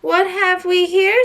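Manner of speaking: slow, high, loud and gloating; triumphant. Acted. Peter lording the find over the others, slow triumphant crow. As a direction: savour the victory over the others. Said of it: "said Peter triumphantly".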